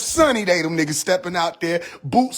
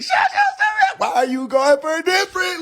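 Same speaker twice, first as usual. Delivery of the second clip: squeaky pitched-up outrage; high mock yell; 9 semitones higher than usual. Comic scolding a rapper over painted nails, high mock yell. Yelling at the nail guy.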